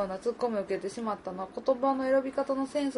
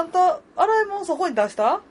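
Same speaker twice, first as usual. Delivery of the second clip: mock mom; 8 semitones higher, 7 dB louder than usual. Playing nagging mother.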